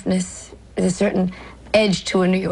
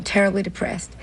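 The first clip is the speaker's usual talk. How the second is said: usual speaking voice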